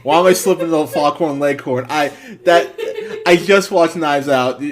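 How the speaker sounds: high, loud words through real laughter; loud laughing protest. Real, not acted. Reviewer asks why the accent slipped out, loudly laughing. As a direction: cry out in laughing disbelief at yourself.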